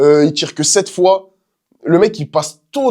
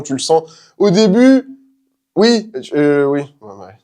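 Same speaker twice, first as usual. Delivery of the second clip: ordinary delivery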